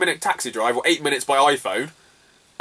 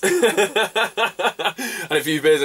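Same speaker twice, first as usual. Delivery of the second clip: laughing; 5 semitones higher, much slower than usual. Real, not acted. Repeat with laugh.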